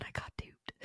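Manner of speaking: breathy self-mocking whisper, almost to herself; rueful whisper. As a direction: whisper it like a sheepish confession. Admits getting scammed, muttered aside.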